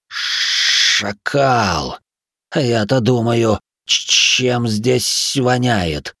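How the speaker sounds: slow, echoing voice inside the head; creature's voice echoing in the mind; distant flashback echo. Acted. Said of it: "Эхом прозвучало у меня в голове".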